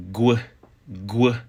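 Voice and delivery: "gw" with a hard g before the w. Shown as a demonstration. Teacher shows learners the W variant, "gw" with hard g. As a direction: say "gw" as one g-plus-w sound. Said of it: "may at times be pronounced".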